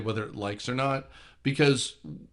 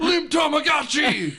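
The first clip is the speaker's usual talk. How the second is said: excited shout; 13 semitones higher, 5 dB louder, slower than usual. Real, not acted. Shouting the joke name.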